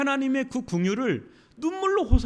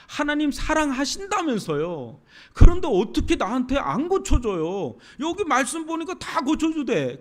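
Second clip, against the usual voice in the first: raised, indignant pleading, higher and louder; angry complaint to God; 4 semitones higher, 4 dB louder than usual. Retold or imitated. Believer venting anger at God, indignant and raised. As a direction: throw a hurt tantrum at God. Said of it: "하나님께 너무 분노하는 분이 있어요".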